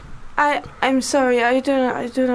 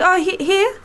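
light put-on French accent, a little higher; fumbling tourist in a hint of French; mock French accent; 6 semitones higher, slower than usual. Flustered French tourist handing over a five pound note, in a light French accent. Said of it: "you still were doing the French".

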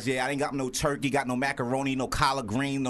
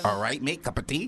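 mock British accent. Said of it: "Do that again".

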